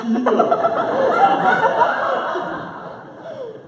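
long high loud laugh; big laugh. Real, not acted. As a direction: crack up laughing. Laughing mid-story.

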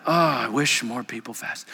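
sanctimonious sigh over others; mock lament. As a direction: lament with false piety.

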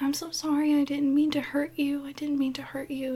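high-pitched imitation of a sorry child; apologetic little boy. Boy to mom after she is cut, high apologetic imitation. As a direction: plead like a kid apologising.

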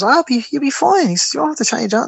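breezy, nonchalant. Retold or imitated. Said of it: "the most nonchalant, casual way".

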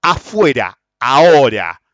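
loud, firm order outside; commanding. Retold or imitated. Challenging the man at the counter, loud command. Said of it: "y señalo el de la caja".

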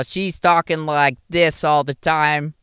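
put-on slow, drawn-out thick Chicago accent. A man mimicking a girl he dated, thick Chicago accent. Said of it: "she had this thick Chicago accent".

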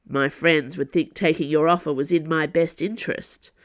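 ordinary delivery

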